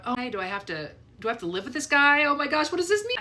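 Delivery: nervous, panicking thoughts tumbling out; retold panic. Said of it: "I'm literally panicking inside".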